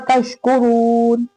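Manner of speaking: तशकुरून chanted with a thick, long "roon"; heavy ra. Shown as a demonstration. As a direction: make the रू in तशकुरून heavy and held. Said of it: "मोटा".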